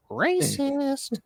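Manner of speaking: high shrill mocking squeal; mock accuser. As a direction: mimic a hysterical accuser.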